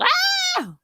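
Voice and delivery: short high excited squeal. Real, not acted. Excited fan squealing to viewers. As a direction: let out a fangirl squeal. Squealing after teenage girl remark.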